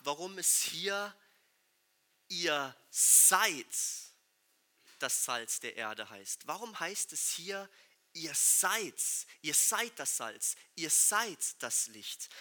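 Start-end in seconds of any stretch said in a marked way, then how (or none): 2.3-6.5 seid stressed and held. Shown as a demonstration. Preacher stressing seid for the congregation. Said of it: "Also die Betonung auf diesem Wort sein". 8.1-12.3 seid stressed, slow and deliberate. Shown as a demonstration. Preacher repeating seid to drive it home. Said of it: "Also die Betonung auf diesem Wort sein".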